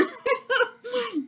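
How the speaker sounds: another high squeal of laughter; real helpless high giggle; high giggling laugh. Real, not acted. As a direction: let the giggle spill out. Still laughing.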